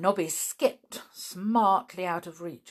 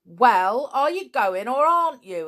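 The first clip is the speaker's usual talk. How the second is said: louder and higher, impatient and angry; losing his temper; 8 semitones higher, 11 dB louder than usual. A narrator's reading. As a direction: demand an answer, temper fraying. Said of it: "Lou began to lose his temper".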